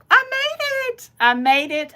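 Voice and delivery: high, sing-song celebration of a win; triumphant cheer. Real, not acted. To her viewers after her first live, a high triumphant cheer. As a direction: crow it out, proud and thrilled.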